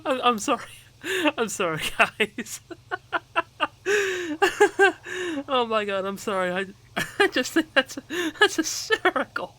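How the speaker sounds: real breathless laughter, high-pitched; laughing hard. Real, not acted. Player apologising to viewers, laughing hard at the story. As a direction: apologise through helpless giggles. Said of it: "out of breath from laughing so hard at that story".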